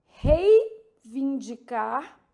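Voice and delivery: Reivindicar in very slow, separated parts; careful word demonstration. Shown as a demonstration. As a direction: split it slowly as "Rei... vindicar". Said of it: "marcando as sílabas tônicas aqui".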